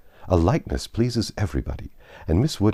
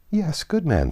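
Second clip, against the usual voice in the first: higher, dry and amused; wry inner thought; 10 semitones higher, slower than usual. A narrator's reading. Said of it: "thought Emma".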